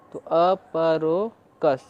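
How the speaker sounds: अपरो कस slow, split in two parts; slowly chunk अपरो कस; sounding out. Shown as a demonstration. Teacher blending syllables slowly for students.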